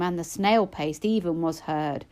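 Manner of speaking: plain reading voice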